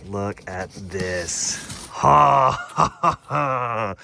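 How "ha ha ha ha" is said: loud delighted laughter; excited laughing. Real, not acted. Excited laugh over new wheels.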